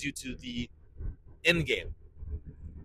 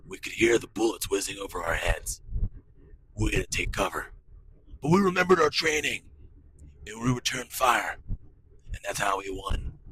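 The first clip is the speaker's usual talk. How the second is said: lower, gritty war-story voice; imitating tough Navy SEAL narrator; 6 semitones lower than usual. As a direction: play a grave Navy SEAL telling a battle tale. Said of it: "they're all like".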